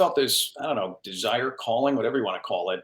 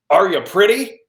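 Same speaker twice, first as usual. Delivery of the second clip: gruff agent asking; 7 semitones higher, 7 dB louder than usual. Retold or imitated. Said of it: "she goes".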